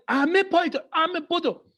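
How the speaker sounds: high, insistent parental call; urgent summons. Acted. Parents summoning kid to the phone.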